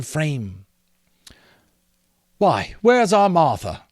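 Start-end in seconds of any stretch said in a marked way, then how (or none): 2.4-3.8 cheerful father. Acted. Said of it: "cried Bob Cratchit".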